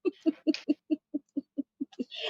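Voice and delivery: high giggling laugh at the table; can't stop giggling; real laughter. Real, not acted. Player laughing at the boast, high giggles.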